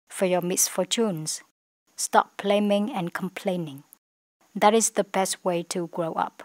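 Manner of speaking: slow, phrase by phrase English read; slow model reading. Shown as a demonstration. Teacher reads the passage in chunks for students. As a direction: pause between chunks for practice. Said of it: "Khi luyện nói, các bạn có thể chia theo nhiều đoạn".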